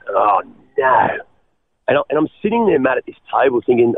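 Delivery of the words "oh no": low, dismayed oh no; dismayed groan. Retold or imitated. Told the boss's wife, a low dismayed oh no. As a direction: sink as the penny drops.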